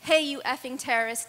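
loud angry shout of a street bigot; retold yell. Bigot yelling at a Sikh man after the attack, loud shout. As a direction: bark it across the street at him. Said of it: "and yelled".

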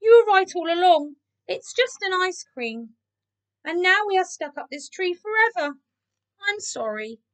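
high, plaintive voice sinking to an apology; sad confession. A narrator's reading. Keith apologising to the cats, sad. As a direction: own up regretfully, falling away at the end. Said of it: "he admitted sadly".

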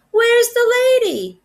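lost dog calling for the lady. A narrator's reading. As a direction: call out anxiously for the lost owner.

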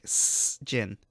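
whispered hissing s, then gin; drawn-out s like a snake. Shown as a demonstration. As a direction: hiss the s slowly like a snake. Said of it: "you gotta say it like a snake".

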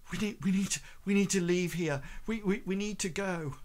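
high, urgent and frightened; panicked stranger begging the party to flee. Acted. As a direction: blurt it out in terror, repeating yourself. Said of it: "see this look of, um, terror".

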